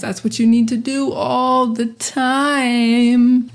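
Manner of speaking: ordinary talk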